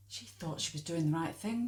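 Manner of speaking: ordinary talk, nothing marked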